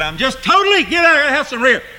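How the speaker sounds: high, loud mock plea of exhaustion; weary complaint. Tired everyman begging for rest, high hammed-up whine. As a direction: whine like someone worn out.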